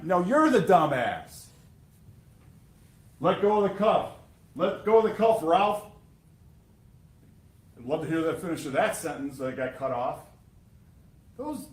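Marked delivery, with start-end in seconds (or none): 0.0-5.8 louder, higher, slowed angry retort; retold angry yell. Prosecutor voicing the officer shouting back at Rios, loud. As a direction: throw back the insult like the furious officer. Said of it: "and Mahan".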